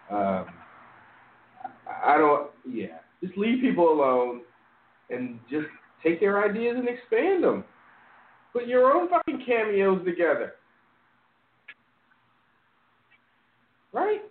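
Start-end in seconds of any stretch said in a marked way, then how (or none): 3.2-14.2 high-pitched, exasperated telling-off; real high-pitched exasperation; exasperated rant. Real, not acted. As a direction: rant in disbelief, voice pitched well up.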